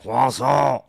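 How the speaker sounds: croissant as a French "kah-SAH", nasal and clipped end. Shown as a demonstration. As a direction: toss off croissant like a Parisian. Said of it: "It's just fun to say it in French".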